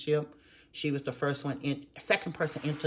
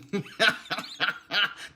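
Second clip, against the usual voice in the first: laugh; 5 semitones higher than usual. Real, not acted. Laughing with her friend Kay.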